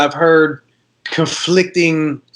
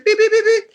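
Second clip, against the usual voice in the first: high electronic beeping; tester beeps. Retold or imitated. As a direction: mimic a machine beeping, way up high. Imitating the diamond tester.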